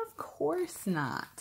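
slow, high, stretched-out denial; unconvincing denial. A narrator's reading. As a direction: deny it slowly, covering up nerves. Fox denies being afraid.

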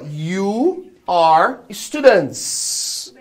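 stretched-out words, one at a time; very slow model sentence. Shown as a demonstration. Teacher addressing the whole class, very slow modelling. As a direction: draw every word right out for learners.

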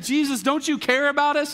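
acted-out worried plea; retold panic. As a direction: imitate frightened men begging for attention. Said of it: "they start freaking out".

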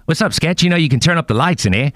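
breezy impression of a New Zealander; [name]. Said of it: "your impersonation's pretty good".

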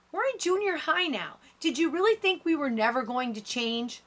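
high-pitched, exasperated challenge; close to shouting. A narrator's reading. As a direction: push it up toward a shout, holding back. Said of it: "Julia is close to shouting".

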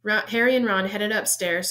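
plain reading voice

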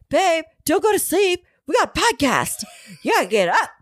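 high, pushy parody of a nagging husband; mock drill sergeant. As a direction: bark orders like a cartoon drill sergeant. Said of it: "Not in that way at all".

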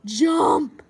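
loud command; shouting. Real, not acted. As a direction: yell it at the screen. Yelling at game character.

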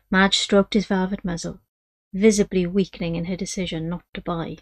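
normal talking voice

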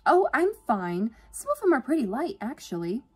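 high, loud, chirpy reassurance; endlessly cheerful. A narrator's reading. Yvonne insisting the boxes are light, endlessly cheerful. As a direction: wave off the offer with high-pitched cheer.

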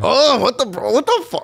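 very high, hammed-up mock dismay; egging him on. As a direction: whine in exaggerated shock to stir things up. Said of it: "I'm not making it any better".

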